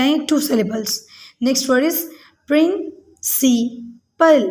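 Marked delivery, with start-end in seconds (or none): 2.5-4.4 "principal" in three slow, separated beats: प्रिं, सि, पल; syllable by syllable. Shown as a demonstration. Teacher counts syllables for pupils, "principal" slowly split in three. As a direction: pause between each syllable of "principal". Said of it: "इसमें three syllables हैं".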